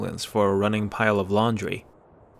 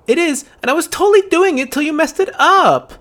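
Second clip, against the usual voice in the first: loud, much higher angry shout; 9 dB louder than usual. Acted. Angry girl shouts at the boy, loud and high. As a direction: shout it at him. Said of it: "Okay, don't shout".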